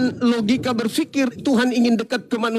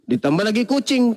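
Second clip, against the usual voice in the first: ordinary talk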